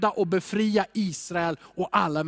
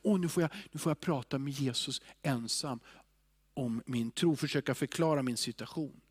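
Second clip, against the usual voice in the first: soft, low imagined musing; hushed, unhurried inner voice; calm, private talk; 4 semitones lower, 6 dB quieter than usual. Acted. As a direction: say it quietly, as if alone with Jesus.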